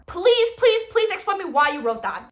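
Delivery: begging the author, loud and fed up; loud pleading; exasperated plea. Real, not acted.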